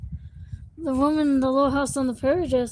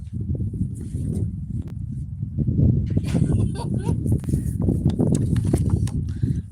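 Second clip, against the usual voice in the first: breathy high-pitched giggling; high giggle. Real, not acted. Laughing after cold joke.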